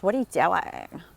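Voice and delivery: loud creaky indignation, mock version; pissed, fried voice. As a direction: imitate an annoyed girl with vocal fry. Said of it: "so she gets pissed".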